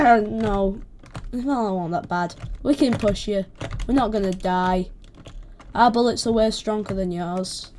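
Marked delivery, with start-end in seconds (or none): none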